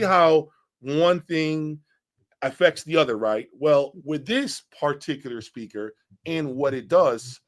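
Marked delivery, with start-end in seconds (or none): none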